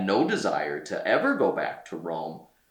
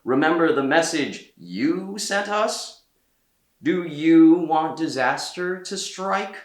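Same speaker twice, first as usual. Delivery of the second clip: louder, higher urgent questions; urgent pressing; 7 semitones higher, 5 dB louder than usual. A narrator's reading. Cardinals remind Peter of his prophecy, louder urgent pressing. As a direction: lean on him with his own warning. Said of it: "the cardinals told him".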